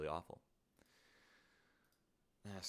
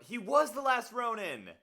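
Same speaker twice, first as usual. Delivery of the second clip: much higher, louder dramatic proclamation; dramatic exclamation; 13 semitones higher, 8 dB louder than usual. Acted. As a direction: cry it out high and loud. Repeats the line louder, acting.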